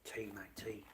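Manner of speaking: hushed whisper, barely voiced. Real, not acted. Streamer whispering to himself about tea, a hushed whisper. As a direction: breathe it out under your breath.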